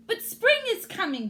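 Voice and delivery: high, loud chirpy voice; chirped. A narrator's reading. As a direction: chirp it brightly like a little bird. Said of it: "Bird chirped".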